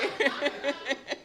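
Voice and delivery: short high laugh trailing off; real laugh. Real, not acted. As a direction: let the laugh run out. Laughing with audience.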